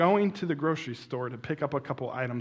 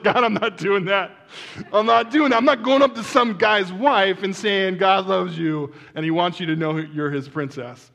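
loud, raised-pitch acted protest; mock indignant refusal; 5 semitones higher, 8 dB louder than usual. Man refusing what God told him, loud mock indignation. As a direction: object loudly, settling down by the end.

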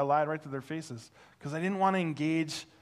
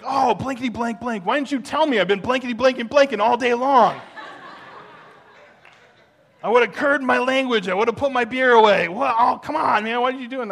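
put-on much louder and higher rowdy drunk rant; 9 semitones higher, 12 dB louder than usual. Drunk neighbor loudly scolding the pastor for not saying. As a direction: blow up in laughing disbelief.

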